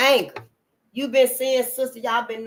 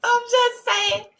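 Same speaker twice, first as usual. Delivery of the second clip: falsetto; 13 semitones higher, slower than usual. Real, not acted. Falsetto aside after laugh.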